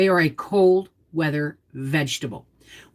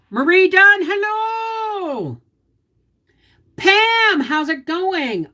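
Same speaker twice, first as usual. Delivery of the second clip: high, louder calling hello; high sing-song greeting; 11 semitones higher, 4 dB louder, much slower than usual. Real, not acted. Host greeting a viewer in the live chat, sing-song. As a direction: call out a bright welcome.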